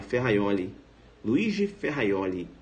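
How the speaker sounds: low "Ferraioli" with a Y glide; Italian pronunciation. Shown as a demonstration. Teacher drills the Italian name for students, correct pronunciation. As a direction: say "Ferraioli" as fe-ha-YO-li, measured.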